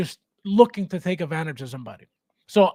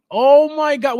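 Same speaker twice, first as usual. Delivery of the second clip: higher, louder, slow oh my God; shocked; 7 semitones higher, 8 dB louder, slower than usual. Real, not acted. Host reacting to a viewer's broken figure, shocked. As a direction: let the bad news land.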